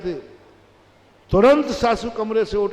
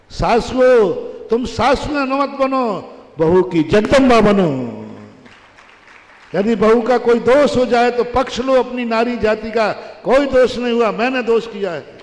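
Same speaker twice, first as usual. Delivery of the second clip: loud, higher exhortation; loud preaching call to mothers-in-law; exhorting preacher; 4 semitones higher, 7 dB louder than usual. Real, not acted.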